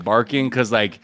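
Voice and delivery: ordinary delivery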